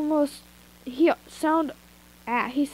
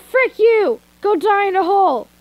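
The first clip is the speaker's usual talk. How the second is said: higher, much louder furious yell; furious shout; 7 semitones higher, 9 dB louder than usual. Acted. Captive screaming at her kidnapper, furious. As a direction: spit the insult at him.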